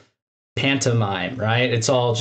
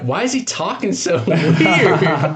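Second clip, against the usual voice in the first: slow, higher, laughing through the words; laughing disbelief; 5 semitones higher, 4 dB louder, slower than usual. Retold or imitated. Host recalling his first watch, laughing in disbelief. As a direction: crack up while asking, drawing it out. Laughing at character's odd speech.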